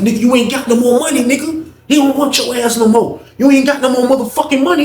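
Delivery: high-pitched mock taunt. [name] taunts Booker about money.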